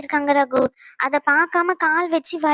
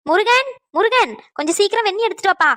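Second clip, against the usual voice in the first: calling out; 4 semitones higher than usual. Acted. Elder calling Murugan for hot water, urgent calling. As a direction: call urgently across the house.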